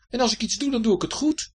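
high, loud, indignant insistence; getting a bit angry. A narrator's reading. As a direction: insist on it with rising irritation. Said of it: "Hij begon een beetje boos te worden". (hoog en luid, een beetje boos)

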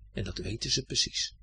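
plain reading voice

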